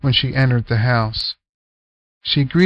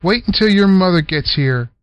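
stern order; 6 semitones higher, 3 dB louder than usual. Acted. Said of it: "ordered Selma".

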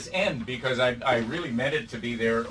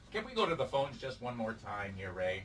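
quiet; 2 semitones lower, 10 dB quieter than usual. Acted. Talk-show host aside in ad.

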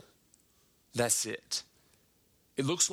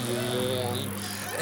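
normal talking voice

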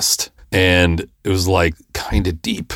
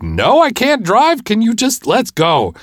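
high, strained, impatient retort; mock-exasperated plea, stretched out slowly; 3 dB louder, slower than usual. Patient urging panicking wife to drive, exasperated.

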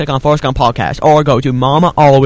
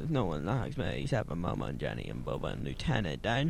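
ordinary talk

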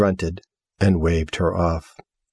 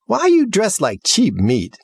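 scornful jab; 11 semitones higher than usual. Acted. Girl mocks the house's clothes, high scornful jab. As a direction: sneer at her outfit.

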